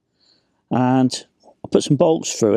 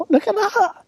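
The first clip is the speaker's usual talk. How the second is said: squeaky-high burst of delight; thrilled at the food; delighted exclaim. Real, not acted. Lifting the lid on the food.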